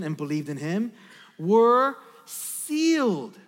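emphatic, declaimed Bible reading; scripture reading. Acted. Preacher reads scripture to the congregation, emphatic proclamation. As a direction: proclaim the passage with deliberate emphasis.

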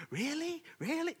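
put-on very high childlike squeal of disbelief; excited kids. Kids hear the roof will be fixed, high excited voice. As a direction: squeak it like an amazed kid. Kids react to father's news.

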